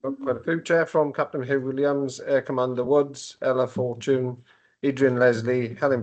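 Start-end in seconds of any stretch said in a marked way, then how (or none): none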